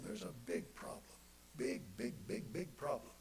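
dropped to a breathy near-whisper; a hushed, grave aside to the congregation; hushed repeat. Acted. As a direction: let the warning sink in softly. Preacher repeats warning, then hushes.

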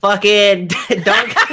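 put-on thick Boston accent, laughing; Boston accent, drawn out slowly. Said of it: "Can you do a Boston accent?".

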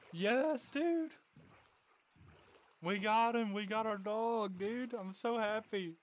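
real excited shouting; ecstatic cheer. Real, not acted. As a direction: celebrate getting the dog back. Dog rescued successfully.

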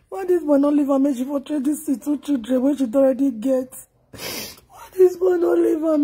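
crying questions with stretched words; tearful lament. Real, not acted. Mourner asking why the boy did not live, lamenting. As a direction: wail the question in disbelief.

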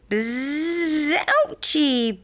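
high buzzed bee voice; buzzing bee. A narrator's reading. Bee bumped by a frog, high buzzing complaint. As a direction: draw out the buzz, then squeal the word high. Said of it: "buzzed a bee".

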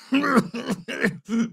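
short high-pitched laugh; wordless burst of laughter. Acted. Laugh between game voice lines.

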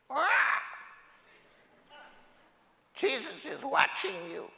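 shrill cartoon parrot warning. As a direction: squawk it out like a parrot. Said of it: "And the parrot says".

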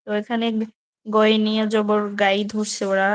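ordinary talk, nothing marked

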